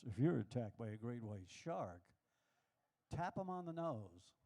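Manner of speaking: low, slow documentary narrator voice; deep, measured nature-show read; narrator impression. Comic quoting the Shark Week narrator, low documentary voice. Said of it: "Narrator said this".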